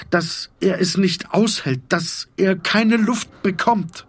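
mounting dread, slowing at the end; rising panic. A narrator's reading. Narration of choking panic. (erzählerische Erstickungsangst, höher und dringlicher)

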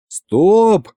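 narrator's mild stop shout. A narrator's reading. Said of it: "завопил и я".

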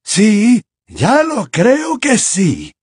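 slow, high, unshaken calm; serene insistence. A narrator's reading. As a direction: insist without raising the voice. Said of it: "insistió Dumbledore, aún con mayor serenidad".

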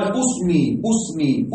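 اسمي said "usmi", opening on a u; drawn-out u vowel starting اسمي; usmi with damma. Shown as a demonstration. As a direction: slowly pronounce اسمي with initial u, "usmi". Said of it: "بالضم وهي لغة ماذا".

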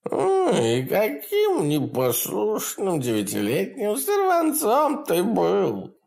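high, gleeful imp voice; cackling hell torturer. Acted. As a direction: taunt him with cruel glee. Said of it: "Бес расхохотался".

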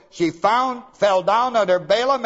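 ordinary talk, nothing marked

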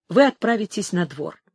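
usual speaking voice

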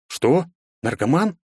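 mild astonishment, pitch jumping; surprised. A narrator's reading. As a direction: echo the word in disbelief. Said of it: "удивился я".

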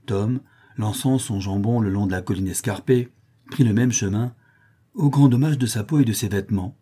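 plain reading voice

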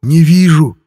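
despairing, helpless cry; anguished cry. A narrator's reading. Laslo cries he sees no one, anguished and loud. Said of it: "простонал Ласло".

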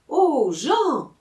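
put-on high, loud childlike exclamation; little girl's voice. A narrator's reading. Little girl calls to her brother, high eager voice. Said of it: "dit une petite fille à son frère".

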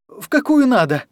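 plain reading voice

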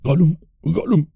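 high gurgling throaty creature voice; gurgling Gollum voice. Acted. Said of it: "gorgoteó en la oscuridad".

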